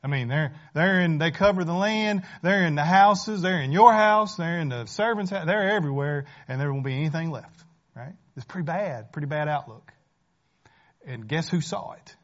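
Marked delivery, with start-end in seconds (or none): none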